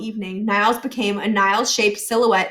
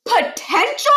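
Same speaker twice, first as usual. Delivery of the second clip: much higher, louder outraged echo; indignant shouted repeat; 12 semitones higher, 4 dB louder, slower than usual. A narrator's reading. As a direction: blurt it in outrage. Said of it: "Miles was shouting now".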